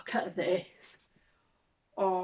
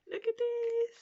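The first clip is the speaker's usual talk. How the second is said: very high excited show-off; high delighted coo; slower than usual. Real, not acted. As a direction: coo over a pretty thing. Shows pretty belt.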